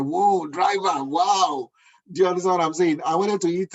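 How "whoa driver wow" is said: amazed. Retold or imitated. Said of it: "I was like".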